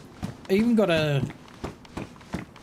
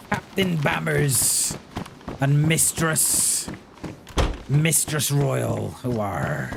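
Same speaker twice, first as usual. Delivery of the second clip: pirate voice; 3 dB quieter, slower than usual. Acted. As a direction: drawl it out like an old sea captain. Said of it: "I even got my pirate voice".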